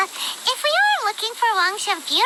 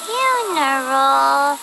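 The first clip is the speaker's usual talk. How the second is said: slow musing repeat; 8 semitones lower, much slower than usual. Acted. Paimon mulls the word funeral, slow and low. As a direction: say Funeral slowly, low and drawn out, as if recalling.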